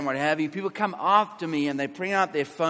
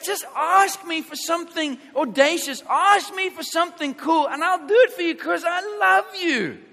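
lightly voiced, higher, loving God speaking; voicing God; 10 semitones higher, 4 dB louder than usual. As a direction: play God coaxing his child, higher and louder. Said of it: "He, he sort of like".

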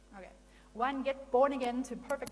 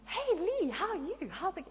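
bright cheerful greeting; 9 semitones higher, 7 dB louder than usual. Retold or imitated. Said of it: "I'm like".

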